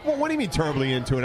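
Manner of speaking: ordinary talk, nothing marked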